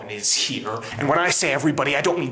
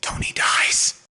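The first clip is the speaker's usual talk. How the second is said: whispered; slower than usual. Real, not acted. Whispered sad reveal.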